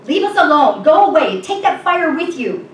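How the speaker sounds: loud, high shouts of rejection; angry shouting. A narrator's reading. Cave dwellers driving the stranger away, shouting. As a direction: drive them off, shouting each order. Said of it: "shout the others".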